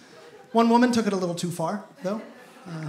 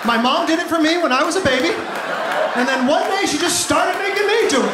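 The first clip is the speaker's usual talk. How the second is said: louder and higher to a shout; building punchline; 7 semitones higher, 4 dB louder than usual. Real, not acted. Comic hitting the last joke, rising to a shout.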